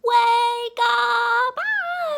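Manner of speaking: very high, very loud shout; piercing mock-alarm holler; yelled wake-up. Shown as a demonstration. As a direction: yell to startle sleeping kids awake. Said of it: "and then I'll yell".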